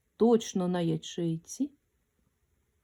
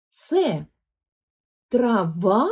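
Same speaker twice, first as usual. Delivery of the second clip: ТРАВА asked with a long, rising final ВА; questioning stress; much slower than usual. Shown as a demonstration. Teacher questions the word to find its stress, rising drawn-out ТРАВА. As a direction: pause after ЦЕ, then stretch the end of ТРАВА upward. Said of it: "Тепер запитаємо про траву".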